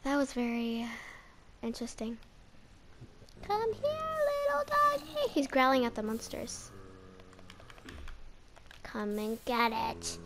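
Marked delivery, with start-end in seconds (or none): 3.4-5.3 much higher coaxing voice. Real, not acted. To the dog, high sweet baby talk. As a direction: coax it like a pet.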